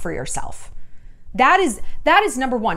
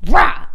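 loud frustrated growl; angry roar; 3 semitones higher, 9 dB louder than usual. Real, not acted. Venting her anger at herself with a loud roar. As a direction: let out a burst of rage.

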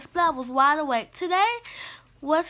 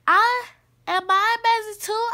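high, louder kitten question; eager young pupil; 6 semitones higher, 5 dB louder than usual. Acted. New kitten asking his teacher about cats, piping up eagerly. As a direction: pipe up eagerly from the back.

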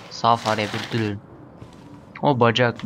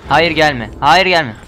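panicked shout; 4 semitones higher, 8 dB louder, much slower than usual. Real, not acted. Monster approaching player.

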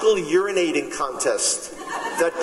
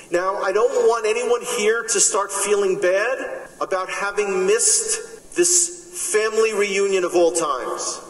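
normal talking voice

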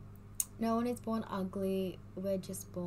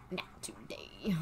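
whisper; 5 semitones lower, 6 dB quieter, slower than usual. Real, not acted. Whispered lyric.

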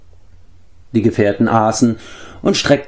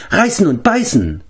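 high-pitched, stretched-out animal chatter; excited creature Gorgi; 10 semitones higher than usual. A narrator's reading. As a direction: squeal it like an eager little beast.

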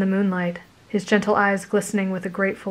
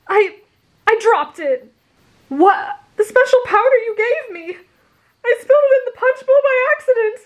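high, loud, rushed confession; guilty shout; 5 dB louder than usual. Acted. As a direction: shout it out beside himself with guilt. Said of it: "he shouted".

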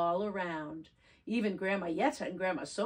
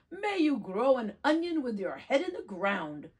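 a hammed-up curse, a bit louder; mock curse shouted; 5 semitones higher, 5 dB louder than usual. Grandma Yetta to the peddler, a hammed-up shouted curse. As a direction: throw the curse at him. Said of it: "she shouted".

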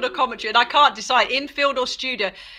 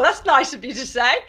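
ordinary talk

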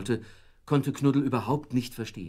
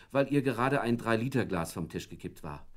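ordinary delivery

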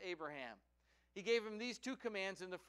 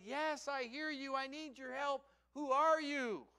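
raised, higher calling voice; 7 semitones higher, 6 dB louder than usual. Acted. Man on the branch answers the voice, calling up. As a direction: call up urgently to an unseen rescuer.

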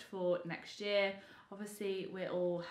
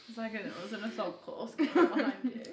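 laughing; 2 semitones higher, 4 dB louder than usual. Real, not acted. Laughing about the cat.

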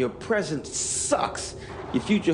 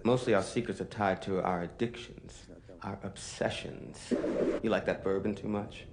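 low, soft musing on secrets; hushed confiding; 5 semitones lower, 5 dB quieter than usual. Acted. Narrator confides to listeners about secrets, hushed and low. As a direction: lower your voice as if sharing something hidden.

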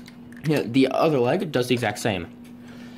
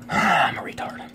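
whispered aside to himself; whispered jab at himself after fumbling the name; self-mocking whisper; 6 semitones higher, slower than usual. Real, not acted. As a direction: mutter it under your breath as a self-jab. Self-deprecating aside after a mistake.

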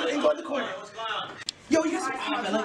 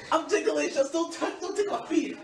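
usual speaking voice

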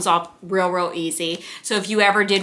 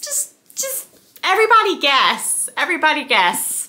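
high-pitched, incredulous squeak; exasperated disbelief; 7 semitones higher than usual. Real, not acted. Book buyer spots a duplicate on the floor, squeaky disbelief. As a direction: squeal it in dismay at yourself. Discovers an accidental duplicate purchase.

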